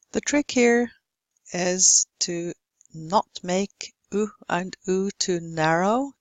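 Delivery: usual speaking voice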